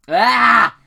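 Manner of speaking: sudden high, loud yell of "ah"; loud cry. Acted. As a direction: burst out with a fight cry. Narrator's cry during the skirmish.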